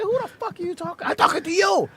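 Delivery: high squeaky comeback; mock high yell. Driver yelling at passenger, high mock shout. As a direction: fire it back high. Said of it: "I said".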